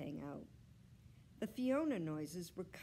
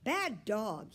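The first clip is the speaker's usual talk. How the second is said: put-on raised, scolding boy's voice; loud, higher-pitched telling-off; shouting; 5 semitones higher, 7 dB louder, slower than usual. A narrator's reading. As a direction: shout at a misbehaving dog. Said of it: "he shouted".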